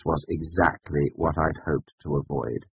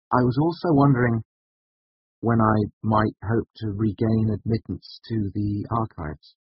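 higher, careful, tentative asking; tentative request; 6 semitones higher than usual. Acted. Student asking the master to be let back, tentative. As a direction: ask a favour you may be refused.

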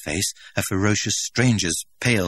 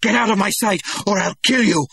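loud, high shouted command; angry shout; 14 semitones higher, 7 dB louder than usual. Acted. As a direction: bark the order in fury. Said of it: "he shouted".

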